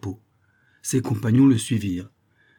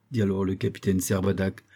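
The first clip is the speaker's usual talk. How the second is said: ordinary delivery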